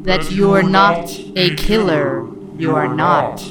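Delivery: slow, loud, cold alien. Acted. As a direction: say it slowly like an alien. Alien captors answer Jim.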